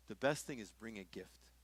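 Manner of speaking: usual speaking voice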